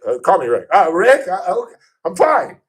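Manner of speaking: high, loud, tripping over his own words; flustered starstruck stammer. Retold or imitated. Radio host retelling meeting his idol, flustered high stammer. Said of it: "was like".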